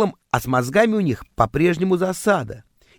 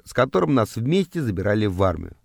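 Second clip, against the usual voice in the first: plain reading voice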